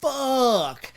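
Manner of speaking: high-pitched mock yell of frustration; retold yell. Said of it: "and I yelled".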